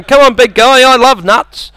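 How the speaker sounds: teasing squirrel. Acted. Comic plays squirrel teasing the English, high teasing voice. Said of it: "They're just teasing you".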